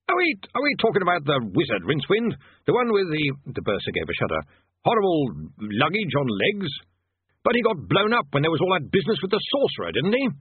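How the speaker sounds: high, nervous stammering wizard; flustered bursar. A narrator's reading. Bursar voiced.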